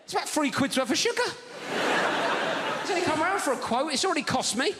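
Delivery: can't believe the number; incredulous. Retold or imitated. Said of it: "incredulous inner rant about sugar".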